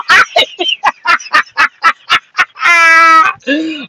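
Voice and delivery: drawn-out helpless cackle; long real laugh. Real, not acted. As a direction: let the laugh roll out. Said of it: "Does my laughter sound like a lie?".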